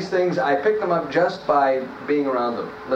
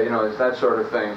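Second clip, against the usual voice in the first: usual speaking voice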